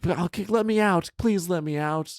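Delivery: high squeaky begging genie; pleading genie. Pretend. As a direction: beg to be let out in a high pitched voice.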